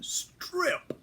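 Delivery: high sharp "Strip!" repeated; barked drill command. Retold or imitated. Instructor repeating the order, high sharp bark. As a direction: snap it out like a drill sergeant. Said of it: "the command comes again".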